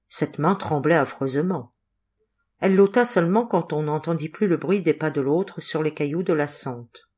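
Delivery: ordinary delivery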